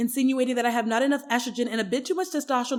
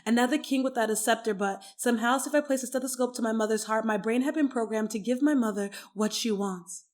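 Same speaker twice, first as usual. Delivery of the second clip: normal talking voice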